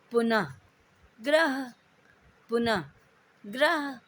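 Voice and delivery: पुनः ends in a faint half h, ग्रह ends in a full h; contrast drill, visarga vs h. Shown as a demonstration. Teacher drilling pupils on पुनः against ग्रह. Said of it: "उच्चारण में अंतर का अभ्यास करें".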